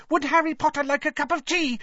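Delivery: high squeaky house-elf voice; Dobby squeaking. A narrator's reading. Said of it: "he squeaked loudly".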